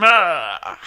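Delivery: short, loud scream; demonstration scream. Shown as a demonstration. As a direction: blast out a quick yell. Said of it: "and scream".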